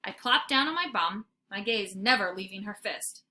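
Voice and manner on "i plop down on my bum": ordinary delivery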